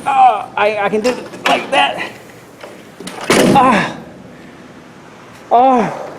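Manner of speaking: high voice from the cold; shivering with cold; freezing cold. Real, not acted. Cold shock outside in freezing wind.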